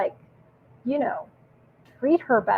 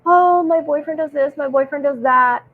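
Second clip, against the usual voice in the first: higher, louder mock griping; mock complaining girlfriend; 5 semitones higher, 5 dB louder than usual. Girlfriend complaining to her friend about boyfriend, mock griping. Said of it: "and the girl's like".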